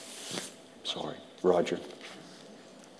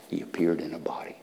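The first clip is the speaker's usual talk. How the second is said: hushed scripture whisper; 4 semitones lower, 9 dB quieter, faster than usual. Acted. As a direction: drop to a reverent whisper.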